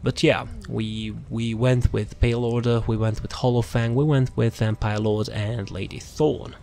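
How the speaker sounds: usual speaking voice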